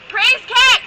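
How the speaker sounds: loud, high burst of a shout; shouted exclamation. Real, not acted. Storyteller bursting out at the punchline, loud and high. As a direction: yell it out like a revival cry.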